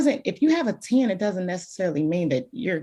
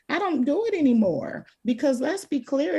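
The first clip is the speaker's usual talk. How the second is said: normal talking voice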